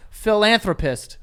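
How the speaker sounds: "Philanthropist" slowly, loudly sounded out; careful word attempt. Real, not acted. Friend who can't say the word, careful "Philanthropist". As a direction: push through every syllable of "Philanthropist", slow and emphatic. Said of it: "can't say this fucking word".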